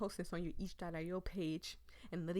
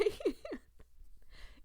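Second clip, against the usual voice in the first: teary laugh; 13 semitones higher than usual. Real, not acted. Laughs after crying out.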